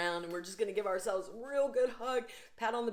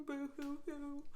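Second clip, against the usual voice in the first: hammed-up soft higher boo hoo; mock crying; 7 semitones higher, 9 dB quieter than usual. Shown as a demonstration. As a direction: play at sobbing, clearly in fun. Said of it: "Laugh or I'll Cry laughter".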